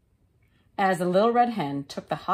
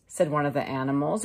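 plain reading voice

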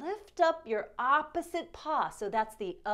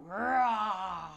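playful roar; 2 semitones lower than usual. Pretend. Roaring lion pose.

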